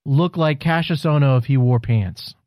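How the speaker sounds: normal talking voice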